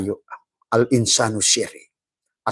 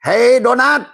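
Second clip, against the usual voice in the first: high, loud comic call; mock call; 14 semitones higher, 7 dB louder, slower than usual. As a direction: shout it to the doughnut playfully. Calling out to the doughnut.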